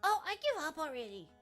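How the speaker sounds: ordinary talk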